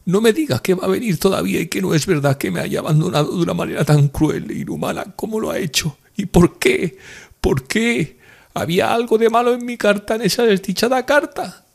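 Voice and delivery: very high, tearful lament; distraught woman's high weeping voice; crying. A narrator's reading. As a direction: plead and wail through the tears.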